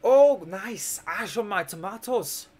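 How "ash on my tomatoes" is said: put-on Pippin's Scottish accent. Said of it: "I've kind of got the accent for a Pippin".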